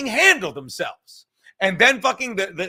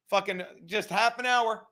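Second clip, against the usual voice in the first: ordinary talk, nothing marked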